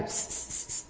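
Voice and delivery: unvoiced psst-psst; short hissed calls to a passerby; imitated catcall hiss. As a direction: mimic a street catcaller's psst. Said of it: "A silbarle".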